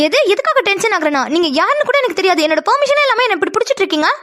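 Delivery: high, heated protest; offended, pitch jumping up; indignant. Acted. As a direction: tell off a stranger who overstepped. Protests being held without permission.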